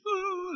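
very high, soft mocking "ooh"; mock swoon. Mocking weepy seekers, a very high ooh.

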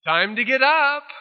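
high hooted wake-up call; high sing-out, playfully imitating a yell; mock yelling. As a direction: holler teasingly from across a mountain. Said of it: "He starts yelling".

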